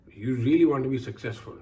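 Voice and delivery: usual speaking voice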